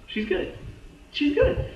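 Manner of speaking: rising from high to squeaky and loud; hopeful relief. Acted. Viewer believing Skye has survived, acted excited relief.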